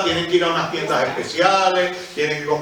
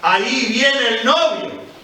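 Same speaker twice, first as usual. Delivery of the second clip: mock shout; 9 semitones higher, 5 dB louder, slower than usual. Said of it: "alguien grita".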